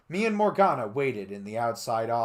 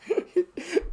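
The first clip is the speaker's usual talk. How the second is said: real laugh; 6 dB louder than usual. Real, not acted. Breaks laughing.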